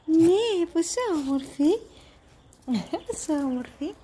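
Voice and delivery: delighted squeal. Real, not acted. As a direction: squeal with joy at how cute she is.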